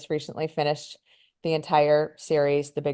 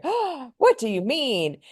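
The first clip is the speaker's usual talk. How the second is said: mock shock; 10 semitones higher, slower than usual. Mom shocked at the Bible, high scandalised voice.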